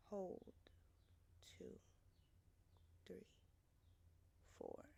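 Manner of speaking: very soft, sparse count with long pauses; guided breathing count. Acted. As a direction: count the breath in a near-whisper. Counts a box breath aloud.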